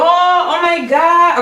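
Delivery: drawn-out gasp of delight; real thrilled awe; awed. Real, not acted. Awe at outfit.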